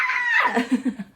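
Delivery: shrill burst of giggling; high squeaky laugh. Real, not acted. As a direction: cut loose with a squeaky giggle. Laugh after joke.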